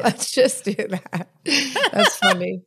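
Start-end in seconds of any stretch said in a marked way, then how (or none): 0.0-1.3 laughing echo. Real, not acted. Echoes friend, laughing.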